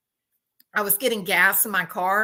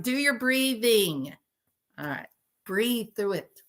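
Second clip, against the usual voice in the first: ordinary talk, nothing marked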